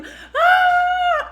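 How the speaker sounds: high mock scream of panicked kids; kids' scream. Kids fleeing from rumored guns, imitated scream. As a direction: shriek like terrified children.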